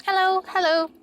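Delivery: high, loud cheery hello; bright greeting. Shown as a demonstration. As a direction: sing out the greeting. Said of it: "ten ways to say hello".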